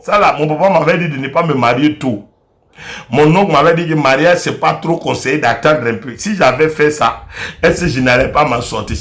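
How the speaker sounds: muttering regrets to himself; rueful inner regret. Acted. Husband's resigned inner regret. (regrets du mari, voix beaucoup plus grave et plus basse)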